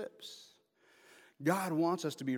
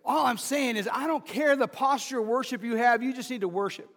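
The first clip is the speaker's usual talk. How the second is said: higher, louder preacher's push; preaching shout; 7 semitones higher, 7 dB louder than usual. Real, not acted. Pastor urging the congregation to worship, loud preaching shout. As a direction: drive the point home to the pews.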